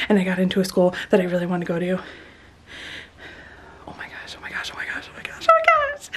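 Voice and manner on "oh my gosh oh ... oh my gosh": whispered oh-my-goshes rising to a squeal; overwhelmed excitement. Real, not acted. Vlogger taking in her school acceptance, overwhelmed excitement. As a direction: whisper frantically, then let it squeak out. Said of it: "this is so exciting".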